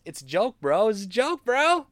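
high mock "joke, bro" voice; sarcastic dudebro brush-off. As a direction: sneer it like a dismissive bro. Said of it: "So now it's the".

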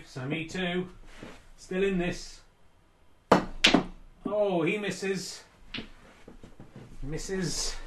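lively snooker commentary; sports commentator. Acted. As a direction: call each shot like live TV.

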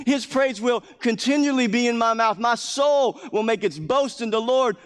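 high, loud, ringing call to join in praise; fervent preacher's call; preaching at full force. Acted. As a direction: lift it like a rallying cry to the crowd.

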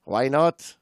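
put-on light Mexican accent, amused. Host voicing the Mexican diver Ramon, in a Mexican accent. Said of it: "the accent is, is spot on".

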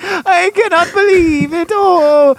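hammed-up high, tearful wail; [name]. Mimicking a bald-wigged actor betrayed by family, melodramatic. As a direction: wail it slowly in mock heartbreak. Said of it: "by the bald wig and just like".

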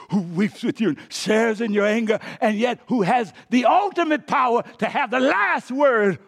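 loud, high swell toward a climax; impassioned preaching. Real, not acted. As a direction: build fervently to a shouted peak.

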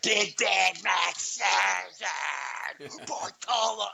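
slow, high drawn-out fan call; fan announcer. Retold or imitated. Fan calling the game for his video, slow high shout. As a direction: stretch the name out like a hyped call.

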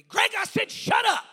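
very high, very loud imitated scream. Mentor silencing a young preacher, imitated furious scream. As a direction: scream it to cut someone off. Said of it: "he screamed at me".

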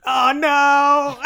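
a sudden high, loud "oh no"; loud dismayed cry. Real, not acted. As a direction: cry out in joking horror. Mock dismay at casting joke.

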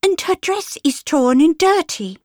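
put-on high little girl voice; a child pointing out rudely; young girl. Little girl tells mamma about the torn dress, high.

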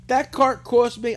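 "that cart cost me" slow, high and loud; slow emphatic repeat. Real, not acted.

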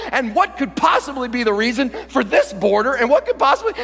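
loud, high, baffled tirade; overwrought, disbelieving outburst; exasperated rant. Acted. Acts out bafflement at the mess.

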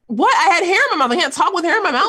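high, loud exasperated protest. Real, not acted. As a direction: defend yourself, voice way up. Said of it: "Must you pronounce that?".

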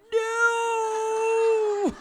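very high, loud shocked shout; scandalised shriek of refusal. Retold or imitated. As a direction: yelp it in mock horror. Shocked reply to cheeky advice.

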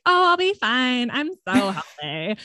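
high, airy mock-confident brush-off; breezy overconfidence. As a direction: wave it off in a sing-song, naive lilt. Acting out her naive past confidence.

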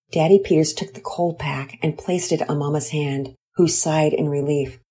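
ordinary delivery

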